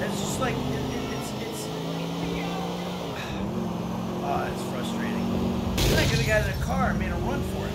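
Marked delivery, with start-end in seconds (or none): 1.7-4.2 long frustrated exhale; weary sigh through the nose; heavy sigh. Real, not acted. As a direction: sigh it out, fed up. Frustrated with the game.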